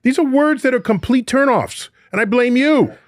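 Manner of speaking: high, indignant mock accusation; mock outrage. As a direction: play up the outrage.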